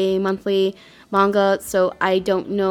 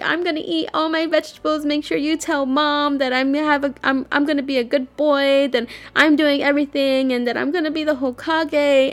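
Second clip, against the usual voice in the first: higher-pitched imitation of a tearful boy; Naruto bawling; 6 semitones higher than usual. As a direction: make tearful promises like an upset kid. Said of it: "like, bawling his eyes out".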